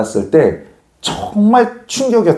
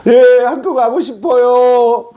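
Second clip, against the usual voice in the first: high, slow, wailing reply; hammed-up wobbly child's wail; mock tearful child; 8 semitones higher, much slower than usual. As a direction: draw it out like a kid crying to a parent. Scared child answering mother after punishment.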